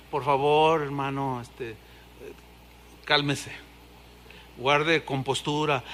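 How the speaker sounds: low, soft, slow calming voice; calm plea. Retold or imitated. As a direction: gently settle an overexcited brother. Pastor calming a worshipper.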